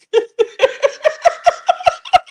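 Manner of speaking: very high, wheezy-long laugh; real laughing fit. Real, not acted. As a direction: laugh until the stomach hurts. Laughs at her own mockery.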